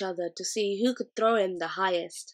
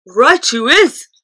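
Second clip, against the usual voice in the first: loud, high, slow gloating cry; Blood Bottler cries out; 5 semitones higher, 14 dB louder, slower than usual. A narrator's reading. Blood Bottler backing the accusation, loud gloating cry. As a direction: crow it out triumphantly. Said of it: "cried the Blood Bottler".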